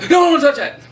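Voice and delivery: high, loud playful shout; a hammed-up warning cry; mock yell. Teasing guests who reach for the puzzle box with a loud, high mock yell. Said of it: "I love when people come over and I go".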